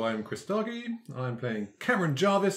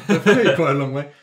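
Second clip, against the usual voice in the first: laughing; 11 dB louder than usual. Real, not acted. Laughing about Briar's long life.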